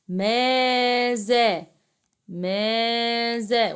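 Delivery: ماذا with both long vowels stretched very slowly; drawn-out question word. Shown as a demonstration. Teacher showing pupils a question word, drawn out. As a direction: hold each syllable of ماذا, slowly.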